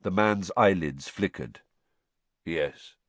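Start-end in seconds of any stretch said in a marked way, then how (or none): 2.4-3.0 low, soft mumble; barely voiced reply; muttered. A narrator's reading. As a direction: mutter it weakly. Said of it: "he muttered".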